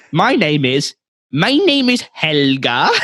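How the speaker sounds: hammed-up patronising Swedish customer; slow, condescending. Customer giving the clerk her name, slowly and condescendingly. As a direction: spell it out slowly as if to an idiot. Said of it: "very slowly in a very condescending tone".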